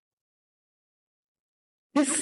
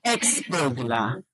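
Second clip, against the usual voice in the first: Ex-burglar slow, EX stressed, burglar light; Ex-burglar said slowly with weight on EX; slow stress drill; 6 semitones lower, slower than usual. Shown as a demonstration. Teacher models the stress for the class, slow and marked. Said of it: "So, one, two, three, four".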